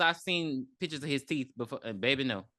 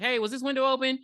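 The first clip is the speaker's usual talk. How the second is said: raised voice as if on a police radio; calling over the radio; 8 semitones higher, 3 dB louder, faster than usual. Retold or imitated. Cop calling his partner over the radio. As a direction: call it out over the radio, a bit higher. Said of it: "over the radio".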